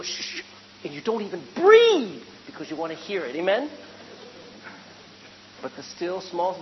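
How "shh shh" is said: soft hushing sound; urgent shushing. Acted. Husband hushing wife over a noise downstairs, shushing. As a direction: shush someone to listen for a noise. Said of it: "And then you go".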